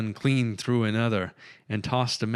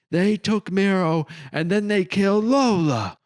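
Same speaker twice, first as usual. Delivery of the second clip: a loud, much higher, furious cry; fiery anger; 11 semitones higher, 6 dB louder than usual. A narrator's reading. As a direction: hurl it out in grief-fed rage. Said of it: "a fire in her eyes".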